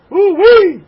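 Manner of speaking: high, loud exclamation; excited whoop. Real, not acted. Rabbi whooping about the riots in Paris, high and loud. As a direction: whoop at the chaos.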